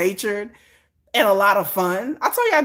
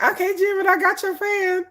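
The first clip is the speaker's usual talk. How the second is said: eager assistant; 8 semitones higher than usual. Pretend. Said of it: "I'll be like".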